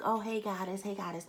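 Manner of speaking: high, breezy mock of a social media greeting; lightly mocking high chirp; mock cute gushing. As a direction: parody a gushy Facebook post. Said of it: "not in the cute way that people like to put on Facebook".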